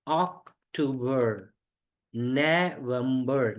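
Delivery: अक्टूबर, नवंबर drawn out slowly; slow classroom demo of अक्टूबर and नवंबर; slow careful pronunciation. Shown as a demonstration. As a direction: sound out the two months slowly for learners. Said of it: "बहुत सारे लोग गलती करते हैं".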